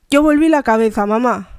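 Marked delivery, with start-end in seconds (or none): none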